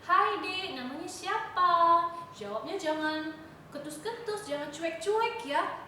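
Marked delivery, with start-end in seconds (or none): 0.1-2.1 friendly stranger. Retold or imitated. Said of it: "misalnya ada nanya nih".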